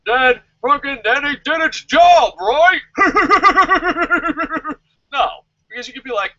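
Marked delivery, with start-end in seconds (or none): 0.0-2.8 high, loud mocking fan shout; mock TNA fan. Mocking a fan defending the storyline with a loud high shout. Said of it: "Super T N A fans would be like". 2.9-4.7 high burst of laughter; loud laugh. Real, not acted. Laughing at his own impression of a fan.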